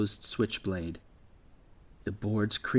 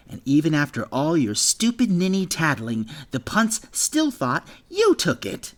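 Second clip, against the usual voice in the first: resentful bully; 6 semitones higher, 5 dB louder than usual. Acted. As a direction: rub it in his face. Bully Gale resents the tattling.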